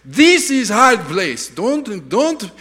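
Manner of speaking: put-on slow, loud, high Russian accent; hammed-up Russian warning; Russian driver. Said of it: "he kept on saying".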